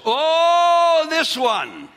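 high, loud burst of recognition; excited shout. Real, not acted. Preacher spotting the next list item, shouting out. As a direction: cry it out like a discovery.

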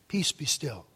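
low, hushed word to the storm; calm quiet command. Retold or imitated. Jesus rising from sleep calms storm.

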